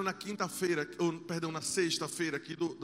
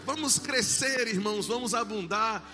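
fervent, loud preaching; 4 semitones higher, 6 dB louder than usual. Acted. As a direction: preach it with full force. Preaching to the congregation.

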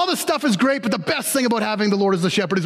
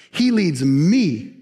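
ordinary talk

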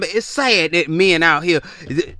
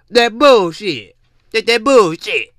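louder, higher, slow; loud, pitched-up scornful dismissal; emphatic dismissal; 4 semitones higher, 6 dB louder than usual. Real, not acted. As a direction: slam it down as nonsense.

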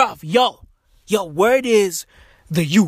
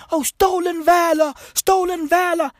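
mocking whiner; 7 semitones higher than usual. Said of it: "Motherfuckers be like".

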